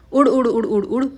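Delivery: comic "उड़, उड़" puffed out like a fart sound; playful fart sound effect for kids; mock fart noise. A narrator's reading. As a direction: blow out each "उड़" as a silly fart noise. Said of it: "उसका पिछवाड़ा खुद ही खुद बोलने लगा".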